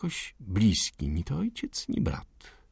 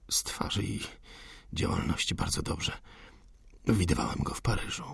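whispering; 7 dB quieter than usual. Acted. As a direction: murmur it so no one hears. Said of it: "Szeptali jak najciszej".

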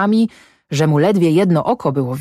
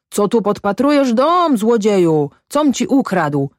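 retold yell; 6 semitones higher than usual. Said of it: "drę się".

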